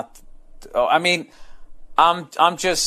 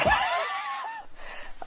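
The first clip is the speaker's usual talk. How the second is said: burst of laughter; 9 dB quieter than usual. Real, not acted.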